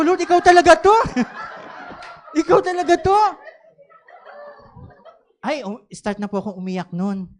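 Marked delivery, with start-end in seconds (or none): 0.0-0.9 laughing plea. Retold or imitated. 2.4-3.2 laughing plea. Retold or imitated. Repeating laughing plea to God.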